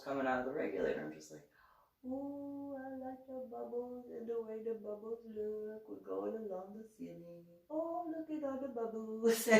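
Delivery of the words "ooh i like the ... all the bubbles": high, soft little marvelling voice; dreamy wonder. Retold or imitated. Diver's inner thoughts watching bubbles, dreamy wonder. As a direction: lose yourself softly in it, pitched up. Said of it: "in my thoughts, obviously".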